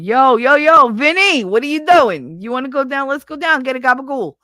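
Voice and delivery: high, loud neighborhood holler; old Brooklyn street call. Retold or imitated. Pal calling Vinny out in eighties Brooklyn, high loud holler. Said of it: "before it was like".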